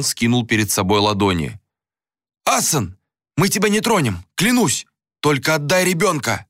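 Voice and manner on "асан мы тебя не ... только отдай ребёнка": high, urgent plea; anguished bargaining for a child's release; desperate pleading. A narrator's reading. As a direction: beg frantically with the pitch raised. Begging bandit to release child.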